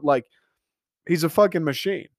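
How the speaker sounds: normal talking voice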